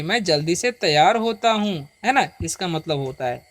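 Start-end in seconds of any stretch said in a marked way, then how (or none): none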